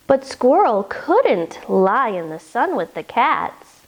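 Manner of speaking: ordinary delivery, nothing marked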